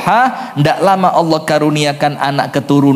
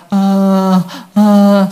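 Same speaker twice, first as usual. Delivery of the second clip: put-on child's labored breathing; 3 semitones higher than usual. Preacher mimics the sick child's feverish breathing. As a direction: breathe out little hot gasps.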